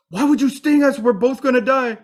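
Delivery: high, loud alarmed cry of disbelief; panicked goose. Acted. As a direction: shriek it as the sting sinks in. Goose has just been stung.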